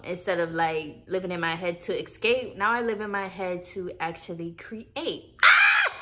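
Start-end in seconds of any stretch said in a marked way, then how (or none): 5.4-5.9 very high, loud yelp of delight; excited shriek. Real, not acted. Thrilled at her own rhyme, a sudden high shriek.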